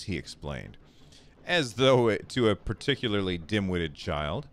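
ordinary talk

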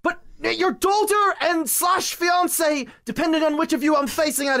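high, urgent, insistent plea; frantic protest. Acted. Protesting that she is missing.

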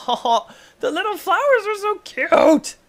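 very high, cooing "so cute"; high cooing baby voice; cooing baby talk. Pretend. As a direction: coo like showing a child a pet. Cooing at flowers.

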